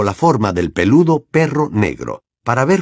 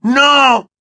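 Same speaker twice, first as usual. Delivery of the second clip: full loud scream, much higher; 6 dB louder than usual. A narrator's reading. Harry screams at the headmaster, throat-tearing. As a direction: tear your throat on it. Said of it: "gritó Harry, tan fuerte".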